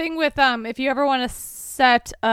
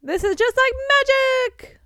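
high, loud delighted gasp; excited exclaim; 13 semitones higher, 7 dB louder than usual. Retold or imitated. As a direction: gush like a showman. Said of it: "Lockhart exclaimed".

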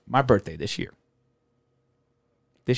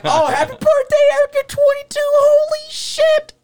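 very high, loud birthday squeal; excited shrieking; 8 dB louder than usual. Retold or imitated. Friends shrieking happy birthday at Eric, very high and loud. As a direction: squeal it like the clock just struck midnight.

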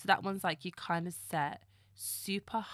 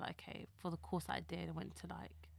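whispering; 10 dB quieter than usual. Real, not acted. Modest aside about uni.

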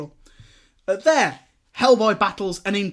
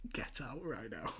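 hushed whisper, barely there; a soft whispered aside; 5 semitones lower, 19 dB quieter than usual. Real, not acted. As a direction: breathe it as a secret joke.

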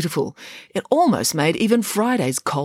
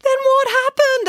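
high, loud demanding headmaster; urgent headmaster; 5 dB louder than usual. A narrator's reading. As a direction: demand the answer now. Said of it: "asked the headmaster".

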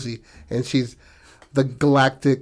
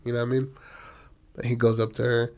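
usual speaking voice

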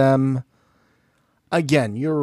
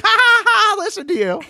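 mocking laugh; 4 dB louder than usual. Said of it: "And they're like".

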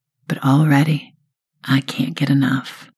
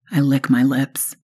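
ordinary delivery

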